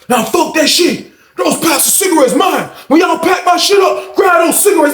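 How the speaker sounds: high shouted protest from the ground; defiant yelling. Retold or imitated. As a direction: holler it while getting cuffed. Cash yelling while pinned down.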